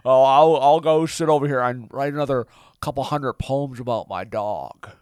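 slink off in a hammy Jimmy voice; Jimmy, meek and dejected. Acted. Jimmy retreats to write dog poems, sulky character voice.

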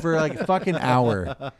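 normal talking voice

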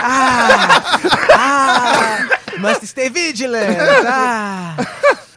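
lightly voiced pope sniffing, slow; mock sniffing pope. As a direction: sniff the air like the pope, drawn out. Mocking pope sniffing a whiff.

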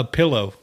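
pillow with a short "ih", PILL-oh; careful pillow. Shown as a demonstration. Proving to friends he says it properly, pillow as PILL-oh. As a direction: say pillow neatly with "ih". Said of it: "You are a pello".

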